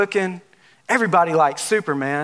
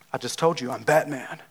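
lower, softer Batman reply; a hint of the stubborn masked hero; 4 semitones lower, 6 dB quieter than usual. Batman answers the curious friend, lower and softer. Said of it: "And you're like".

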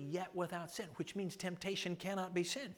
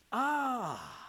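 normal talking voice

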